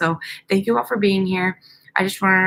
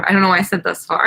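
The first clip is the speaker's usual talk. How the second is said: normal talking voice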